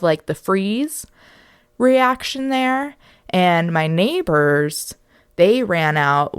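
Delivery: ordinary delivery, nothing marked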